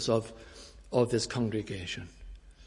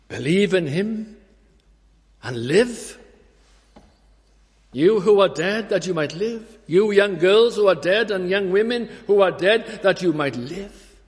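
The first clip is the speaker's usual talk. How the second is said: raised, higher voice driving it home; fervent preaching; 7 semitones higher, 7 dB louder than usual. Acted. Preacher urging the dead to live, fervent and raised. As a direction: preach at full force to the congregation.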